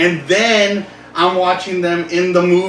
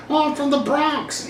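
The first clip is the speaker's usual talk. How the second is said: mock British accent; 10 semitones higher, 4 dB quieter than usual. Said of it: "hear him in that British accent".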